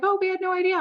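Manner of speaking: the sales team learning about their boss, high amazed surprise; surprised. Retold or imitated. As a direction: caught off guard by the news. Team surprised by boss's hobby.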